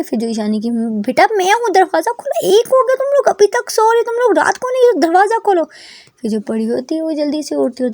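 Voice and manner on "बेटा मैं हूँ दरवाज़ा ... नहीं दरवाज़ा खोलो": scolding mother. A narrator's reading. Mother calling through door.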